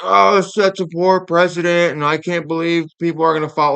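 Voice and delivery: mock TV pundit scolding; mocking old TV pundits. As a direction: ham up a stuffy commentator tutting. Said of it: "all the old dudes on T V were like".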